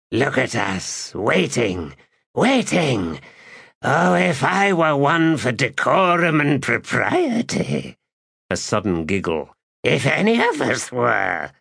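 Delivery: high, mocking spirit voice; sly spirit voice. A narrator's reading. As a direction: tease them, sly and high. Shadow Throne voiced, giggling.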